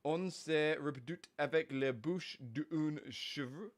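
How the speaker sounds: slow, careful French accent; French accent attempt. Shown as a demonstration. Unsure of the Marseille accent Lily wants, slow tentative French accent. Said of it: "I don't know what the accent is".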